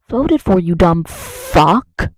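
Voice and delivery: loud, drawn-out angry insult. Real, not acted. Berating a Trump voter directly, angry. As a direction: spit the insult out slowly and loudly.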